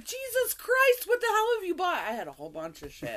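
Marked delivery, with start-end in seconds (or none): 0.1-2.0 much higher, loud mock disbelief; shocked dad exclamation. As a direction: squeal it like a stunned dad seeing the haul. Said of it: "they come back and they're like".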